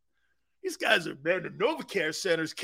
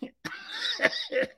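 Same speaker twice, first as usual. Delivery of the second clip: very high squealing mock laugh; a phony canned on-air cackle; fake radio laugh; 4 dB quieter than usual. Mocking radio hosts' fake laughs, very high. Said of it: "Those fake-ass laughs".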